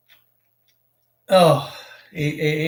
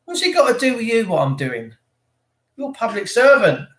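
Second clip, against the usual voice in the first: acted high-pitched protest at an officer; indignant retort; 7 semitones higher, 3 dB louder than usual. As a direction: challenge the police, pitch jumping up. Acted quarrel with police.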